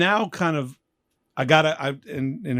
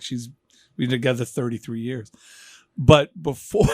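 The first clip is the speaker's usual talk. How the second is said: usual speaking voice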